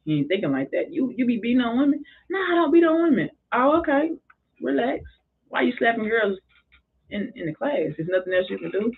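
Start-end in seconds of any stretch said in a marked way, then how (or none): 0.9-2.0 mock disbelief, higher and quicker; incredulous question, raised pitch. Teacher to boy who slapped a girl, mock disbelief. 2.3-3.3 put-on high pitched boyish denial; mock boy's denial. Boy denies hitting women to his teacher, high mock voice. 3.5-8.9 calm mock teacher. Teacher calming then asking.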